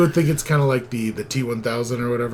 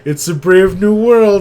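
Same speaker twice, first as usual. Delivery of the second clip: grand pronouncement; 8 semitones higher, 8 dB louder than usual. Retold or imitated. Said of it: "she's like".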